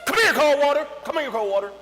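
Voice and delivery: high, loud singsong calling; calling the water. Retold or imitated. Kriegel calling to the water with the stick. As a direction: coax the water like a pet.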